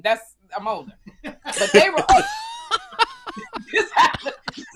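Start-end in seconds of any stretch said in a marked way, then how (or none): 1.2-4.6 laughing. Real, not acted. Laughing about her boobs.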